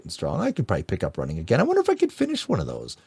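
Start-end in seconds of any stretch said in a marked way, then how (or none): 1.6-3.0 much higher and quicker, half-amazed; excited high-pitched musing; high, fast wondering. Retold or imitated. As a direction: lift it up high and rush it like a daring thought.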